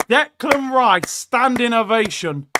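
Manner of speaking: loud, high, stretched-out name; rousing acclaim. Real, not acted. Fan calling for Rice's standing ovation, rousing acclaim. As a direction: hail the player like a proud fan. Hailing player coming off.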